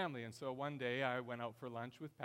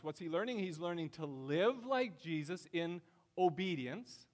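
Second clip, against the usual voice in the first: higher, louder, driving preacher's voice; full-force preaching; 7 semitones higher, 7 dB louder than usual. Acted. Pastor rallies church to make disciples, preaching hard. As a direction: preach it with rising fire to the congregation.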